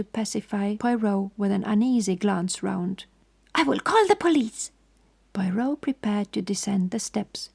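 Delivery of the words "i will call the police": higher, shrill-free loud threat; infuriated threat. A narrator's reading. Poirot threatens the doorman, loud and higher. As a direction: bluster it like an outraged Frenchman. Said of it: "caricature of an infuriated Frenchman".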